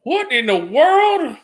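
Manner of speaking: high, slow mock outrage; shocked boss. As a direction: stretch it out in disbelief. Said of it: "She says".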